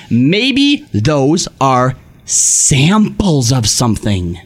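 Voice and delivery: usual speaking voice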